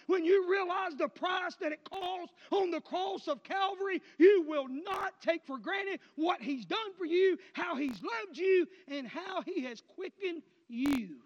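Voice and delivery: loud, high preacher's cry; full-force preaching. Acted. Preacher thundering at his congregation, loud and high. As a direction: drive it home to the congregation.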